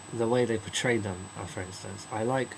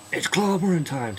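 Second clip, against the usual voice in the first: higher, louder battle cry; loud catchphrase; 7 semitones higher, 8 dB louder than usual. Retold or imitated. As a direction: shout the catchphrase. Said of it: "he says".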